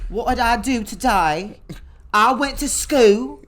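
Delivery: put-on British accent. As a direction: draw it out like a UK drag queen. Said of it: "that's how they talk on Drag Race".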